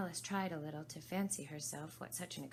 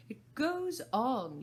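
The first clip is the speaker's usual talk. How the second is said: slow, high, louder Hatter; 8 semitones higher, 10 dB louder, much slower than usual. Acted. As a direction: draw it out as the Hatter. Hatter voiced in story.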